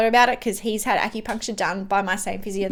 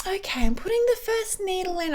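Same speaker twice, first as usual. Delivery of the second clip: calm physio; 9 semitones higher, 4 dB quieter than usual. Retold or imitated. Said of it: "Katie's like".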